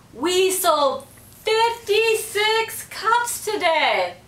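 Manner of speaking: high, slow, proud announcement; excited yell. A narrator's reading. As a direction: shout the news happily. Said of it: "Sherry yelled".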